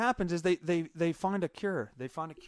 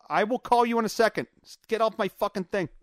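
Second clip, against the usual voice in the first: louder, higher exasperated brush-off; irritated; 5 semitones higher, 6 dB louder than usual. Real, not acted. Streamer to interrupting friend, real exasperation. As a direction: firmly shoo off an interrupting caller.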